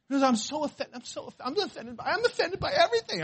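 high-pitched, hammed-up complaint with a laugh; mock offended whining. Preacher mimicking people offended by everything, mocking whine.

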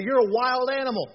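playful shout at his daughter; playful loud tease. Retold or imitated. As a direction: tease her loudly, full of fun. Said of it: "We used to tell Kate".

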